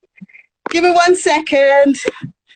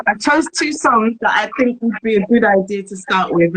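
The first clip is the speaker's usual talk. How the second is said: normal talking voice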